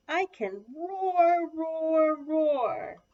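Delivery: loud, high, drawn-out roars; triumphant roaring. A narrator's reading. Little Lion can finally roar, loud triumphant roaring. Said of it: "said Little Lion triumphantly".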